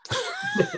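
a burst of high startled laughter; startled laugh at a fly; sudden loud laugh. Real, not acted. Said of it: "that was a little loud".

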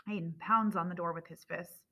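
ordinary delivery

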